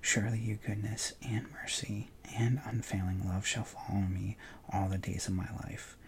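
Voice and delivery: normal talking voice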